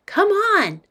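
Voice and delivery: put-on high-pitched, urging kid; insistent little girl. Girl drags boy toward house, insistent high voice. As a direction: urge them along in a child's voice.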